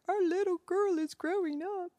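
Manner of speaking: lightly voiced choked-up husband; a hint of a husband on the verge of tears; teary husband. Mother imitates her teary husband after tucking their daughter in, lightly choked. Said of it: "came upstairs teary-eyed".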